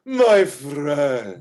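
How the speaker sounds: loud, grand greeting in character; effusive tavern hostess. Acted. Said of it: "comes up to your table and says".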